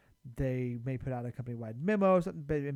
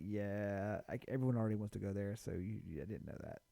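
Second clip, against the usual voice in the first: lower, softer retold excuse; imitated reluctant reply; 5 semitones lower, 6 dB quieter than usual. Coworker explaining the lunch choice is settled, reluctantly. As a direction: mimic an awkward brush-off, trailing quieter.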